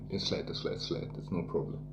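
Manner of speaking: breathy, unvoiced mumble of reassurance; whispered it's flat, no problem; hushed brush-off. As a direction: let it fall to a whisper. Fobbing off the player's request.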